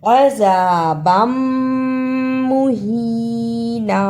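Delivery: अज़ाबम मुहीना with tanween merged into a long hummed m; idgham. Shown as a demonstration. Teacher demonstrating merged letters, अज़ाबम मुहीना with held m. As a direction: hum through the m of अज़ाबम into मुहीना, slowly. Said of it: "मिलाकर पढ़ना है".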